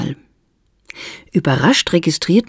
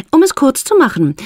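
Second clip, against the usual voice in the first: Esther, a touch of a challenge; 11 semitones higher than usual. A narrator's reading. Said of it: "fuhr Esther fort".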